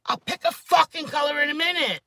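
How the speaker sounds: high, loud fed-up snap; exasperated retort. Retold or imitated. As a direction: fire it back in frustration. Snapping back at nail lady.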